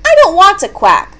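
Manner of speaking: a touch of storybook sadness; sad little duck. A narrator's reading. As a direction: plead it like an unhappy duckling. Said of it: "said the duck sadly".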